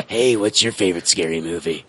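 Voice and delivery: low movie-villain phone voice; lightly menacing horror-caller imitation; Scream phone killer. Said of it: "sounds like the guy that calls".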